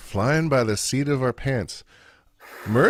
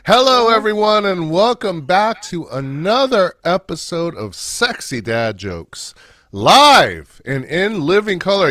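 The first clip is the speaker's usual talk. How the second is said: show host intro; 6 semitones higher than usual. Acted. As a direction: open the show like an announcer.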